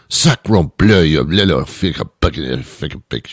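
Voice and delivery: mock French cursing that falls into babble; upset French accent. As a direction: sputter like an outraged French customer. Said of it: "French people do that too".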